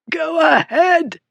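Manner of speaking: very high, slow strangled croak; squeezed, high-pitched defiance. A narrator's reading. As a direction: croak out a last dare. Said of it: "the man croaked".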